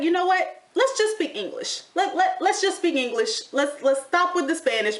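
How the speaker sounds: mock exasperation, half laughing; exasperated. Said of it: "I was like".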